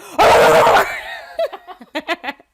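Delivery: loud, high, drawn-out yell; hammed-up screaming outburst; wild mock scream. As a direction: scream over him in a play fight. Acted fight, wordless yell.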